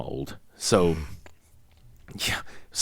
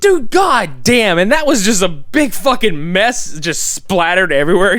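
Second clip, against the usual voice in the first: excited; 9 semitones higher, 6 dB louder than usual. Real, not acted. Telling the co-host about the van crash, excitedly. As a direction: stretch out the amazement, pitched way up. Recalling a gory funny scene.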